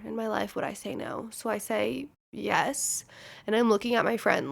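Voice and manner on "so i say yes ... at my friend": ordinary talk, nothing marked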